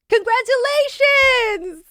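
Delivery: high, slow, loud congratulating squeal; excited caller. Retold or imitated. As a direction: gush the news like a thrilled casting agent. Caller on the phone cheers.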